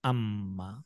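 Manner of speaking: अम्मा with the mim pressed; doubled mim. Shown as a demonstration. Teacher to children, modelling the doubled mim. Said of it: "अम्मा से क्या हो जाएगा".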